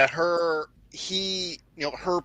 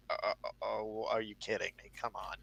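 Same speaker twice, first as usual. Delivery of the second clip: much softer, stunned under the breath; muted disbelief; 5 semitones lower, 13 dB quieter than usual. Retold or imitated. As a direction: mumble it like you can't believe the screen. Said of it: "And I'm like".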